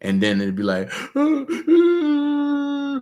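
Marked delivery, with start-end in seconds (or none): 0.9-3.0 mock breakdown. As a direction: wail it like you're at the end. Imagined breakdown after something bad.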